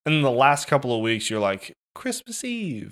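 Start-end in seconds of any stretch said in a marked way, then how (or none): 1.9-2.9 high, soft and drawn out; soft holiday sigh. Acted. Voicing people in the last weeks of the year, soft high drawl. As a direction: stretch it out softly, high in the voice.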